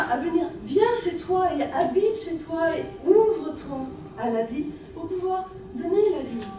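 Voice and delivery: normal talking voice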